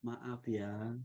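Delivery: very quiet, low and gentle; hushed, humble apology; soft, slow-down apology. Shown as a demonstration. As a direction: say sorry tenderly, almost under your breath. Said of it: "intonasi permohonan maaf yang lembut".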